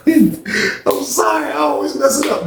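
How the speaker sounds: high, slow, squeezed through laughter; helpless laughing at own slip; real laughing apology. Real, not acted. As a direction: apologize while cracking up.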